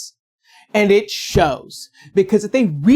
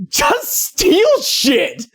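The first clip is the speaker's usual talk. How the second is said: laughing while saying it; 5 semitones higher, much slower than usual. Real, not acted. Laughing through joke advice.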